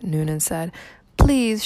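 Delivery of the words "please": higher, pleading please; begging. A narrator's reading. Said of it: "she begged".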